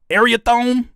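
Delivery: "Ariathone" opened like "area", ending "-tone"; puzzled mispronunciation. Shown as a demonstration. Streamer guessing at the name, "Ariathone" said like "area-tone". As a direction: try "Ariathone" high and loud as one word.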